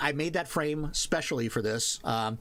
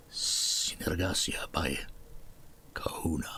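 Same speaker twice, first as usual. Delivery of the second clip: cologne ad voice; 8 semitones lower, 3 dB quieter than usual. Pretend. Streamer parodying a cologne ad for viewers, cologne ad voice. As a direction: murmur it low like a fragrance spot. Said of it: "It sounds like a perfume or men's cologne".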